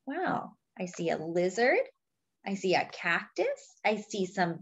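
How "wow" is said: usual speaking voice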